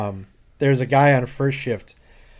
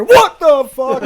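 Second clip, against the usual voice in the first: high, loud, startled yell; startled shout; 11 dB louder, slower than usual. Retold or imitated. Startled old coworker yelling at his opening CD tray. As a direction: jump out of the chair yelling.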